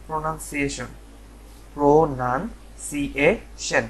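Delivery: প্রোনাউন্সিয়েশন as "pro-nan-si-ei-shen", then slowly by syllable; correct pronunciation. Shown as a demonstration. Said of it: "এর সঠিক যে উচ্চারণটা".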